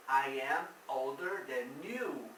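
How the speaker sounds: slow, careful English example; slow model sentence. Shown as a demonstration. Teacher modeling English for students, slow and clear. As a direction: spell the sentence out slowly for the class. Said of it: "Eu quero falar old".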